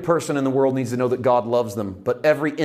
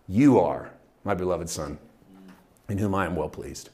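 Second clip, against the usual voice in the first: lower, soft intimate words; tender personal voice; 6 semitones lower, 4 dB quieter than usual. Retold or imitated. The Spirit telling Jesus directly, tender. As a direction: say it gently to one person.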